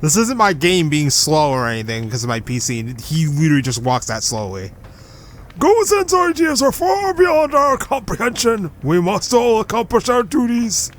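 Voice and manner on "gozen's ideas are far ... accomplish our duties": high-pitched loyal underling voice; stiff, high devotee voice; high character voice. Acted. Character defends leader.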